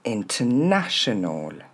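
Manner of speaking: INTERNATIONAL as "in-te-NA-she-nol", slowly; drawn-out teacher's "international", no R; model pronunciation. Shown as a demonstration. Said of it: "Je vous invite à répéter après moi".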